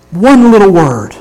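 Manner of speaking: usual speaking voice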